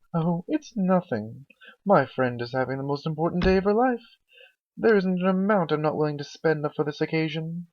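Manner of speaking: Rarity, gracious. Acted. Rarity brushing off Twilight's thanks, high gracious voice. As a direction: wave off the thanks airily.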